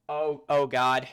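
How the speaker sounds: a loud, alarmed outburst; alarmed oh God. Real, not acted. Reacting to a tribute finding explosives, loud alarm. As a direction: blurt it, bracing for the worst.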